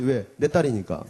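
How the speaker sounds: ordinary talk, nothing marked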